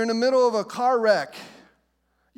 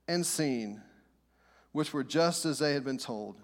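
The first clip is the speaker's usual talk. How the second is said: measured, lower Bible reading; scripture reading; 5 semitones lower than usual. Acted. Pastor reading the shepherds passage to church, measured and low. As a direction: read the passage to the congregation.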